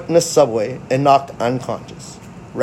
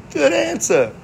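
Good answer. pitched way up, falling; high approving; 13 semitones higher than usual. Retold or imitated. Approving the answer, pitched high.

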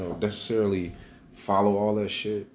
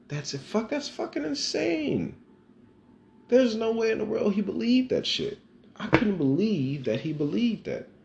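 very high, louder, stretched disbelief; incredulous rant; 4 dB louder than usual. Real, not acted. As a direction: nearly squeal how insane it is. Ranting in disbelief about brother.

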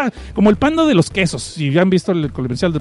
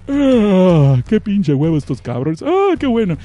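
bored kaiju; slower than usual. Acted. Kaiju shrugging off bullets.

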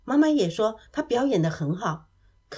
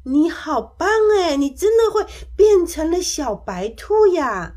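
high, loud amazed admiration; delighted praise; 6 semitones higher, 7 dB louder than usual. A narrator's reading. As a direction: cheer your friend on, thrilled. Delighted praise of a friend's trick. (惊喜地高声夸赞)

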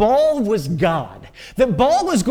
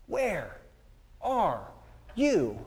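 softer, word by word with long pauses; forlorn question; 2 semitones lower, 10 dB quieter, much slower than usual. Acted. Elijah alone calling to God, forlorn. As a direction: let each word drop away quietly.

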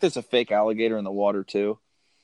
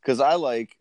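ordinary talk

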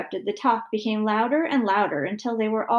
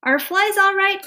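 put-on high, loud little boy; calling out; 11 semitones higher, 8 dB louder than usual. A narrator's reading. Son calling back about frog food, loud. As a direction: call it across the house. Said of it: "called Stevie".

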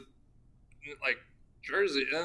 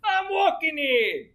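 high, slow New Jersey caricature; mock New Jersey accent; 11 semitones higher, 4 dB louder than usual. Co-host joining the accent jokes, exaggerated New Jersey accent. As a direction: draw it out in hammed-up Jersey accent.

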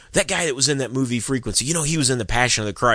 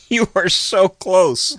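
high drawn-out teasing; excited teasing; 8 semitones higher, 4 dB louder, much slower than usual. Acted. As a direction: egg him on, so close. Teasing hint, high and slow.

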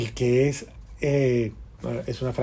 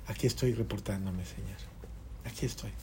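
quiet prayer; 5 semitones lower, 12 dB quieter than usual. Retold or imitated. Juan praying before the Sacrament.

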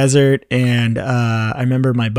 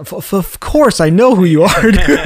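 higher and louder, tripping over the first word; starstruck stammer; 6 semitones higher, 5 dB louder than usual. Retold or imitated. College fan meeting his idol Tom in the RV, starstruck stammer. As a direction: gush it out like a nervous fan.

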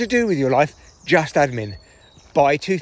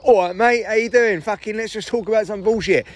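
casual matey opener; 6 semitones higher than usual. Retold or imitated. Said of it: "I just used to start this podcast all like".

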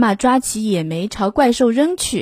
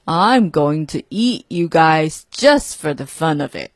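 monster voice; 4 semitones lower than usual. A narrator's reading. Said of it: "bellowed the monster".

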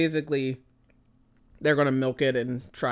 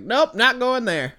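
mock refusal; 9 semitones higher, 7 dB louder, slower than usual. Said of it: "I was like".

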